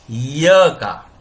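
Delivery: य drawn out slowly; slow model. Shown as a demonstration. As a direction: hold the य sound for the class. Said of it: "कौन सा sound निकलता है".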